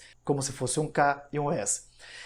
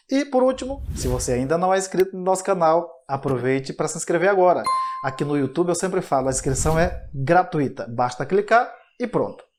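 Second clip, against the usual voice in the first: louder, brisk sign-off announcer read; teacher urges viewers to like, share and subscribe, in an energetic outro voice; upbeat channel outro; 5 dB louder than usual. Acted.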